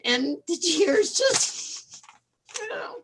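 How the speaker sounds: fighting back tears while explaining; choked up, on the verge of crying; tearful. Real, not acted. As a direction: voice breaking with real tears. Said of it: "excuse me if I cry".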